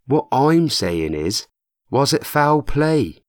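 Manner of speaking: slow, weighted, leaning on foul; slow and pointed. A narrator's reading. Matt hinting to Chrissy at murder, slow and pointed. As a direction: drop each word slowly to hint at murder.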